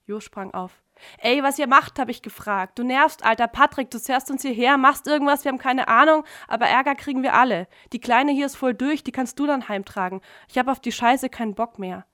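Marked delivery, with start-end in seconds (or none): 1.2-12.0 louder, higher angry rant; angry outburst. Acted. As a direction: let rip at him, fed up. Jo angrily confronts Patrick. (Jo fährt Patrick wütend an, laut und höher)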